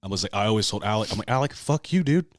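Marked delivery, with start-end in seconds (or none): 1.3-2.3 raised, higher playful griping; comic sore-loser protest; mock outrage. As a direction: curse out a friend who keeps winning. Acted mock complaint to winning rivals.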